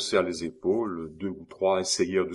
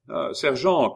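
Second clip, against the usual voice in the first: raised, high sentry's hail; calling out; 7 semitones higher, 6 dB louder than usual. A narrator's reading. Sentry hailing the sergeant, calling out. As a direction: call across the yard. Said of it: "cria la sentinelle".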